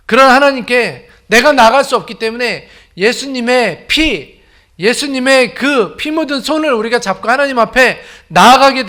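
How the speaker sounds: loud, high impassioned preaching; preaching at full force. Acted. Pastor proclaiming to the congregation, loud and fervent.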